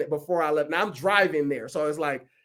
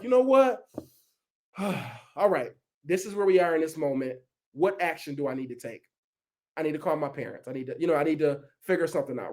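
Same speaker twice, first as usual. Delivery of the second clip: softer, settled self-talk after a sigh; calm acceptance; 5 semitones lower, 5 dB quieter than usual. Retold or imitated. Speaker retelling calming himself after the nanny flaked, softly. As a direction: talk yourself down after a big breath. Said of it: "Take that big breath".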